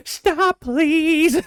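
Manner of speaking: very high, loud strained begging; high desperate plea. Retold or imitated. Begging dad to stop chewing, high desperate squeal. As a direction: squeal it like you can't take it. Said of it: "I'm just like".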